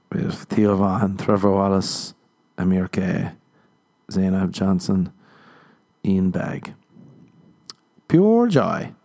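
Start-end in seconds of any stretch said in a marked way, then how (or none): none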